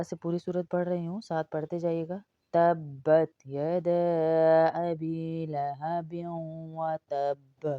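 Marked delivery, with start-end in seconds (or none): 2.5-7.7 slow measured tajweed recitation; Quran recitation. Acted. Teacher recites the whole surah for students to follow. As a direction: recite the surah slowly, melodic.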